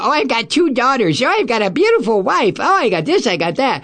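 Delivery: mocking imitation. Host mocks Kavanaugh bragging about family, mockingly sing-song. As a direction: mimic his bragging with a lilting sneer. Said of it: "don't give me this shit about".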